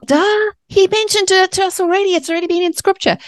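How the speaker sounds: high, mocking duh, rushed at the end; sarcastic duh. Mocking herself as she reacts to the scripture, high and sarcastic. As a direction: roll your eyes at the obvious. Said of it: "I'm like".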